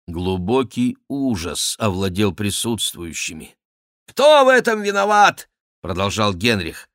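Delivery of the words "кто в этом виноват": high, loud angry question; furious demand. A narrator's reading. King Henri hunts for the guilty one, furious shout. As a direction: demand an answer in a rage. King rages, seeks the culprit.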